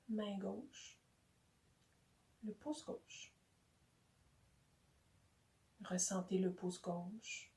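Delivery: soft, unhurried body-scan voice with long pauses; hushed, calm relaxation guide; guided meditation. Real, not acted. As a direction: guide them gently, phrase by phrase, into rest.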